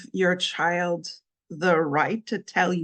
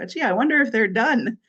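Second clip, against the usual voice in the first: higher, playful mock wondering; cheesy joking sarcasm; 5 semitones higher, 4 dB louder than usual. Shown as a demonstration. Teasing someone over burnt cookies, cheesy sarcasm. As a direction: pitch it up like a corny joke. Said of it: "just like a funny, kinda cheesy joke".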